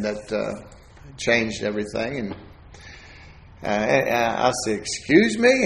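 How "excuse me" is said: surprised. Retold or imitated. Surprised by stepdad's skunk question.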